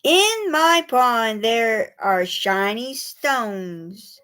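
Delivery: slow, gentle reading; poem for children. A narrator's reading. Reading a pond poem to young children, slow and gentle. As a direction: savour each image slowly.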